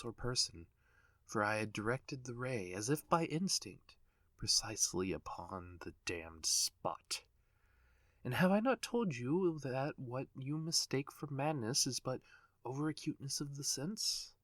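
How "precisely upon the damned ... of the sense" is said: ordinary delivery, nothing marked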